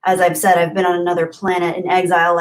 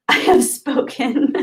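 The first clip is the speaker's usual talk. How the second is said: mock grand pronouncement; 5 semitones higher, slower than usual. Reading a viewer's quote in voice.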